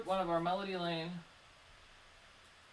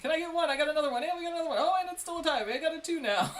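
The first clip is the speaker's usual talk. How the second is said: auctioneer; 9 semitones higher, 8 dB louder, faster than usual. Pretend. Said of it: "I feel like an auctioneer".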